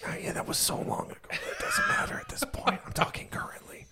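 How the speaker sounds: playful hushed whisper. Pretend. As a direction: drop to a whisper. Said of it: "Why are we whispering?".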